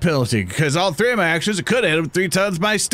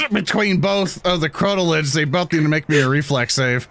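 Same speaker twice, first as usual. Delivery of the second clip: ordinary talk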